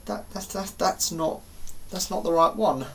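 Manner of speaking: quiet, halting realisation; stunned dismay. Retold or imitated. Seeing the wrong station at last, stunned and quiet. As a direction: stammer it softly in acted disbelief.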